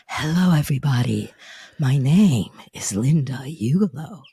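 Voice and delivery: low, put-on character introduction. Shown as a demonstration. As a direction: drop well down into a character voice. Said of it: "like I did with the characters before".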